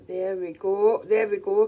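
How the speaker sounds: normal talking voice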